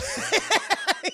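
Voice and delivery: loud, high burst of laughter; high cackle. Real, not acted. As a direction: crack up at your own impression. Cracks up at own impression.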